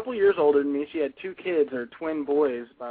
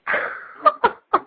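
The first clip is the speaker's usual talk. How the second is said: laughing; 8 dB louder than usual. Real, not acted. Roommate reacts to feeding suggestion.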